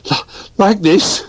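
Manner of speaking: out of breath from running, high and stumbling; breathless little bear; puffing. Acted. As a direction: gasp it out mid-run. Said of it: "he puffed".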